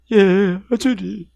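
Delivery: slow tearful whimper, lightly acted; mock crying. A believer breaks down weeping before God, mock sobbing. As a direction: imitate someone weeping. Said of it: "막 우는 거야".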